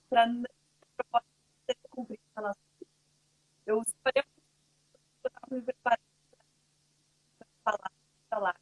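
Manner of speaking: choppy audio, cutting out; breaking up on a bad connection; corrupted glitchy audio. Real, not acted. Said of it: "tu tá cortando um pouquinho".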